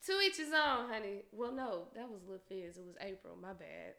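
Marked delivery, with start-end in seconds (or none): 1.3-3.9 a little tipsy, words trailing quiet. Real, not acted. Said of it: "I'm a little tipsy".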